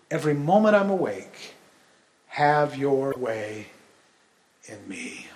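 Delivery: hushed worship reading; devotional reading. Acted. Reads song lyrics devotionally.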